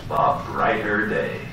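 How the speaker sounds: usual speaking voice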